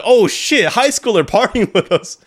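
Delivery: put-on a stoked crowd cheering on the kid; amazed partygoers.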